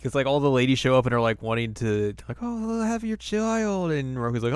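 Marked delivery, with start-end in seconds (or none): 2.4-3.9 high, slow breathy swoon; swooning lady. Retold or imitated. Village ladies swooning at Moroku, high and slow. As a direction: sigh it like a lovestruck village girl.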